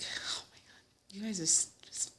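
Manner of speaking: hushed, exasperated whisper; breathy aside to the fleeing guests; retold whisper. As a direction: whisper it in mock despair. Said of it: "I was like".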